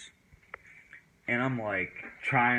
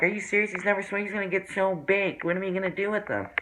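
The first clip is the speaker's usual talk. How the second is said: much higher voice, imitating an exasperated friend; retold incredulous complaint; 9 semitones higher, faster than usual. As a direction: mimic a friend fretting, pitched up.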